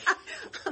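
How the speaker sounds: high, bright burst of laughing; burst of laughter. Real, not acted. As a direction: break into real laughter. Laughter at committee hearing.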